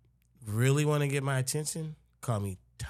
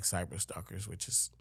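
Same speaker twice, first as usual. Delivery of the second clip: whisper; 5 semitones lower, 10 dB quieter than usual. Real, not acted. Drops voice about stalkers.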